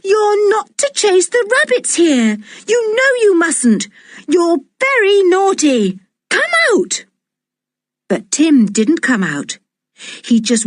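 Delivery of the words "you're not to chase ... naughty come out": high, scolding call to a naughty dog; scolding shout. A narrator's reading. Girl scolding her dog stuck in a hole, shouting. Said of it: "shouted George".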